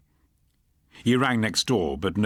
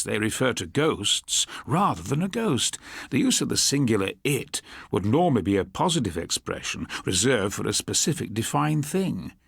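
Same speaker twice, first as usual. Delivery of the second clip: ordinary delivery, nothing marked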